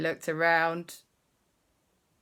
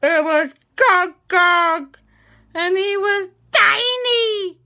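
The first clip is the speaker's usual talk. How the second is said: dramatic reveal; 13 semitones higher, 10 dB louder, slower than usual. A narrator's reading. Storyteller's big reveal.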